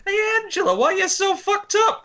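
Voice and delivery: very high, loud squeaky voice; high squeaky movie quote. Retold or imitated. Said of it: "One of my favourites is still".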